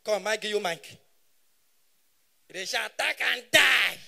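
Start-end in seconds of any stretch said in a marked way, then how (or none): 2.5-4.0 very loud, building to the last word; hammed-up shouted threat; mock slogan shout. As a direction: belt it out like a crowd chant.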